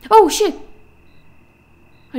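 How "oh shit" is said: sudden loud startled curse; startled yelp. Real, not acted. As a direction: jump at the scare and yell. Startled jump scare.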